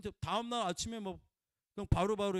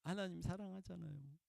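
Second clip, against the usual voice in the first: much softer, tender admission; gentle smiling confession; 3 semitones lower, 9 dB quieter than usual. Retold or imitated. The hurt woman telling of her love for God, soft and tender. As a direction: say it softly with a small smile. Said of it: "웃으면서 또 얘기해서".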